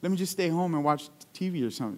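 softly imitated childish pout; mock reluctant grumble, kept soft; retold pout. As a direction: pout quietly to grandma, lightly acted. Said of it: "I would kinda pout".

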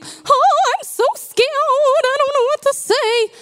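high, hammed-up frightened whine; mock scared. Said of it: "Your sixty seconds of being".

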